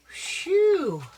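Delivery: high, loud relieved phew. Acted. Dog Spunky relieved after making the jump, a high phew. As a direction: blow out the relief.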